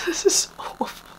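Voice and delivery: whispery laugh of disbelief; laughing despair. Real, not acted. Reacting to an awful blouse, laughing in despair.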